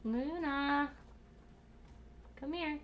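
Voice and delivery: high sing-song pet call; coaxing call. Real, not acted. Calling Luna over, high and coaxing. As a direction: coax the cat over, high.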